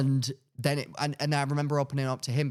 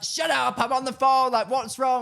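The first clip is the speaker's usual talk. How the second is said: high, louder snappy mum voice; mock irritated mum; 11 semitones higher, 5 dB louder than usual. Mum interrupted on a phone call, mock irritated snap.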